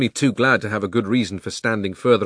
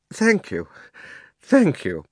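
faint; 6 semitones higher, 4 dB louder, slower than usual. Acted. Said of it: "in a faint voice".

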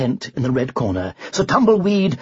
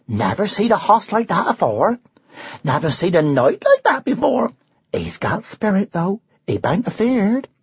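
a hint of a West Country yokel accent; lightly done rustic dialect; 5 semitones higher than usual. A rustic onlooker marvels at the knight, light West Country accent. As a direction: gossip like a country bumpkin.

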